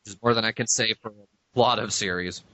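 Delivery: ordinary delivery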